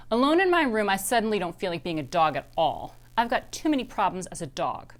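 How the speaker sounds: plain reading voice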